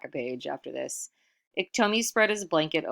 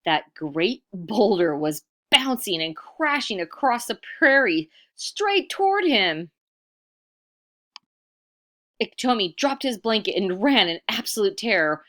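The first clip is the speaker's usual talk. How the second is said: louder, higher, urgent telling; dramatic build; 4 semitones higher, 8 dB louder than usual. A narrator's reading. Narrator tells the boulder chasing Iktomi, louder and urgent. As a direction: make the chase thrilling.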